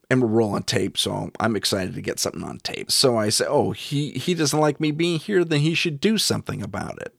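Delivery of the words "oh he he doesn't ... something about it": retold challenge, pitched up; challenging. Investigator provoking the entity that dislikes him, challenging. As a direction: mildly taunt an unwelcome host. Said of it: "that's when I start challenging".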